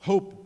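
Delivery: HOPE with a breathed H at the front; sounded English H. Shown as a demonstration. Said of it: "o H tem som de R".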